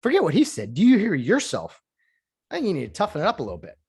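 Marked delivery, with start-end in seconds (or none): 0.0-1.8 scolding. Acted. Scolding the soft player.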